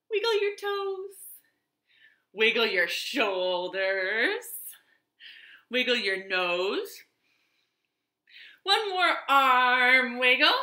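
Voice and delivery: put-on sing-song, coaxing the children to wiggle; playful stretch-along. Teacher leading children in a stretch, sing-song. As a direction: lead the wiggles like a kindergarten teacher.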